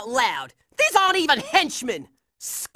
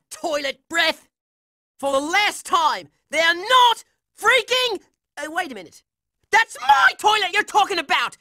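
ordinary talk

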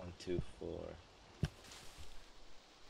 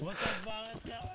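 high, alarmed retold outburst; confused panic; 12 semitones higher than usual. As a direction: play it like sudden chaos. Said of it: "and we're like".

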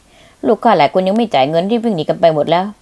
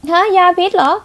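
shocked; 11 semitones higher, 3 dB louder than usual. Acted. Told bullet is poisoned.

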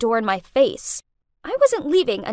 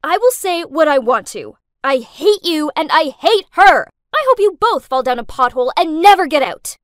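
loud, heated outburst; furious shouting, driving on to the end; angry yelling; 4 semitones higher, 10 dB louder than usual. A narrator's reading. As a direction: yell it down the phone, pounding the stresses. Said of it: "so I yelled".

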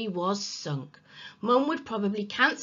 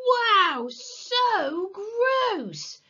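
high, loud, drawn-out relish; thrilled at something revolting; gleeful disgust; 13 semitones higher, 6 dB louder, much slower than usual. A narrator's reading. Henry opening the forbidden mummy kit, gleefully grossed out.

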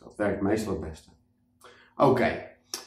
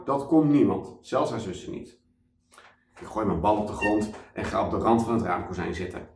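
plain reading voice

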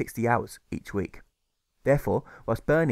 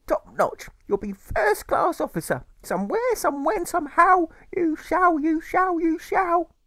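mother's voice; 5 dB louder, slower than usual. Acted.

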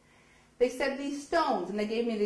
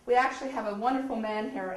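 ordinary talk

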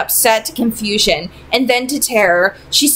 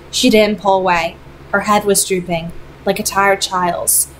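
plain reading voice

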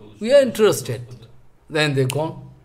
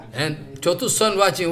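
ordinary talk, nothing marked

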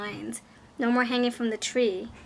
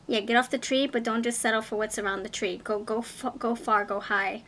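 usual speaking voice

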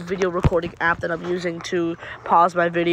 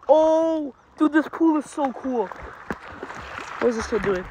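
ordinary talk

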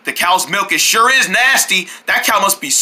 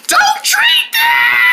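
high desperate slow scream; frantic scream; 3 dB louder, much slower than usual. Acted. Frantic warning.

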